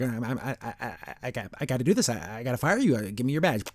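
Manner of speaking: soft stammer, reluctant firing; meek big guy. Retold or imitated. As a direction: imitate an unscary boss fumbling quietly through bad news. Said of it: "but he's not scary".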